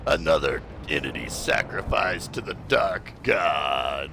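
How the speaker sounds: low, booming villain proclamation; mad scientist. Acted. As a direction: gloat like an evil doctor. Villain gloating over a sacrifice.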